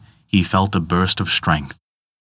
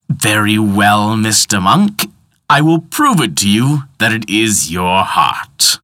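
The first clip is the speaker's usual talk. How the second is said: slow, louder, steaming villain; irritated villain; 3 semitones higher, 5 dB louder than usual. Acted. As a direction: bristle with irritation. Tick-tock man begins to steam.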